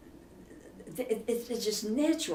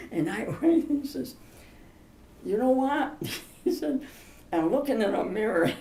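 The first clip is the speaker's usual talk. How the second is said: laughing; 5 semitones higher than usual. Real, not acted. Laughing through the retold joke.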